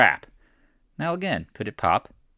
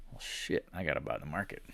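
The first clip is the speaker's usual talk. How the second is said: whispered, grudging "gotta buy" to yourself; mock muttered panic; 9 semitones lower, 17 dB quieter than usual. Cash holders caving as the market rises, whispered grudging mutter. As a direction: breathe it out like a trader caving in. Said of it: "they go".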